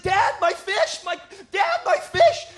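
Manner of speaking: very high, loud mock child's wail; hammed-up boy's distraught yelling; destroyed little boy. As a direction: cry out like a devastated kid to his dad. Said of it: "the kid's just destroyed".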